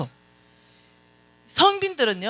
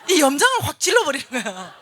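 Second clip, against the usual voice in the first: mock exasperation; 6 semitones higher, 6 dB louder than usual. Aggrieved at friend's taunt.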